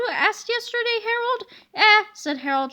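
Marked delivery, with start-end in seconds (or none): 1.7-2.0 shrugging eh. A narrator's reading.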